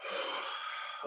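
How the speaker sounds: long, soft breath out; real weary exhale; sigh. Real, not acted. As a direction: sigh quietly before going on. Steels himself to continue.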